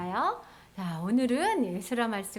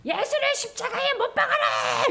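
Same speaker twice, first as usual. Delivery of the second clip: high-pitched mob cry; strained, high-pitched chant of a hostile crowd; crowd's loud demand; 11 semitones higher than usual. Retold or imitated. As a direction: act out a crowd crying out for punishment. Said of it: "큰 소리로 해가지고".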